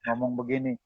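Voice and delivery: ordinary talk, nothing marked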